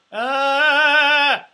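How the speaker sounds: loud high sung ah with the neck craned up; strangled high note. Shown as a demonstration. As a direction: reach up and choke the note, the wrong way. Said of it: "You're, you're strangling yourself".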